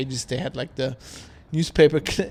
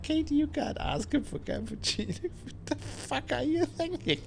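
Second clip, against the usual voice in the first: high, softer scolding through laughter; laughing mock reproach; 12 semitones higher, 6 dB quieter than usual. Husband retelling how he scolded his wife, laughing mock reproach. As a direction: scold in a high voice while cracking up.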